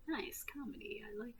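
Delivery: hushed, unvoiced whisper; whispered aside. Comedian mutters approval of comedy to himself, whispering. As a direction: whisper it to yourself.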